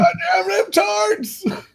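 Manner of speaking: put-on grumpy old man. Old man ranting at liberals.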